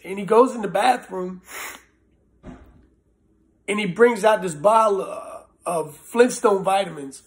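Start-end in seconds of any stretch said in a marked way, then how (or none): none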